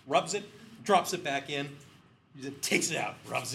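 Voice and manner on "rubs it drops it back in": usual speaking voice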